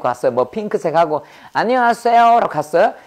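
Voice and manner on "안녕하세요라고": high, loud mock-cutesy hello; perky newcomer greeting. Pink-clad new employee greeting the office, high and perky. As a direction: pitch the greeting way up, overdone.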